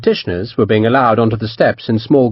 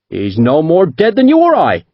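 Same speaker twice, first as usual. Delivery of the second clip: high, assured Devonshire captain; confident captain; 5 semitones higher, 3 dB louder than usual. A narrator's reading. Captain dismissing the merchant's lie, Devonshire accent. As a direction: call his bluff with calm certainty.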